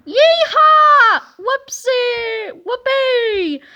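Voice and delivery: high, loud crazy yeehaw; hammed-up giddy hollering; wild whoops. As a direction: whoop like teachers gone wild. Teachers go crazy.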